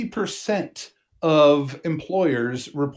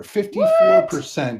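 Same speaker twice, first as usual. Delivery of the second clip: jumps high on fifty-four; surprised reveal. Real, not acted. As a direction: announce it with a gasp.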